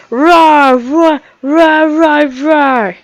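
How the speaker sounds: string of loud, high roars fading a little; roaring boss. Acted. Dino boss roaring orders at the detective, fading roars. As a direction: roar again and again like an angry dinosaur. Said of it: "I like that our boss roars".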